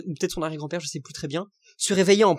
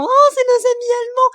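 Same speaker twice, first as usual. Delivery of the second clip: much higher, loud sing-song mock; sarcastic mimicry; 8 dB louder than usual. Vlogger mocking talk of German friends, high sarcastic voice. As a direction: sneer at the friendly-Germans line.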